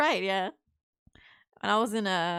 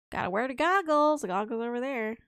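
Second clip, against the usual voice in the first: ordinary talk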